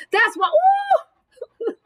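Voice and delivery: excited preaching broken by high whoops; really excited. Real, not acted. Preacher thrilled about saints defeating giants, real excitement. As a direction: overflow with joy, whooping between phrases. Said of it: "that's why I'm so excited about these".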